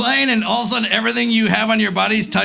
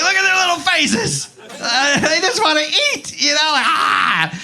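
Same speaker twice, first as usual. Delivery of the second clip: mock weepy sob; 7 semitones higher than usual. Crying over dogs in a commercial, hammed-up high wailing. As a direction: overact tearing up at a commercial. Crying at dog food ads.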